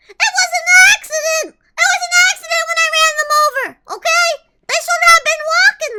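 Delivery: high, loud, fast indignant protest; mock protest. Prisoner pleading it was an accident, loud mock protest. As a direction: insist on innocence in a hammed-up yell.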